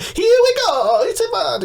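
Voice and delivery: high, loud mock Mario voice; hammed-up Italian plumber shout; Mario impression. Mocking the movie Mario with a loud high voice. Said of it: "doesn't talk at all like Mario".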